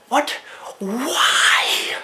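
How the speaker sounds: high, loud, drawn-out disbelieving question; exasperated disbelief. Real, not acted. Fan protesting why only Golem is machine, exasperated. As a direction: demand an explanation, exasperated. Baffled protest about card types.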